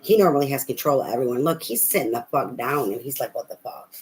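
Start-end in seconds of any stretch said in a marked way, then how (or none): 3.3-3.9 breathy whispered disbelief; imitating the man, whispered in shock; stunned whisper. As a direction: whisper it like he's floored. He sits down stunned.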